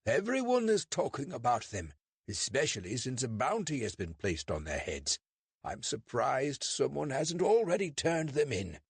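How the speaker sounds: David's put-on British accent, pitched high. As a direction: fake a polite British accent to fool a constable.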